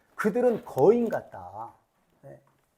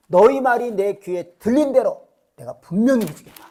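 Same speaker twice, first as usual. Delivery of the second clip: louder and higher, hammering declaration; emphatic divine verdict; 4 semitones higher, 8 dB louder than usual. Acted. Preacher booming God's verdict to the congregation, loud. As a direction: declare it with full force.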